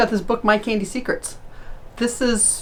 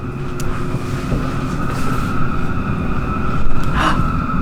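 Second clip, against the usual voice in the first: gasp. Acted. Sneaking up, sudden discovery.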